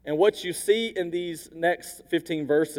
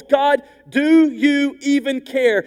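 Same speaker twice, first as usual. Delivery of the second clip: high, loud and drawn out slowly; slow pleading cry; 8 semitones higher, 7 dB louder, slower than usual. Retold or imitated. Habakkuk asking God if he cares, slow loud plea. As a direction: stretch each word of the plea. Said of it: "Habakkuk's cry to God".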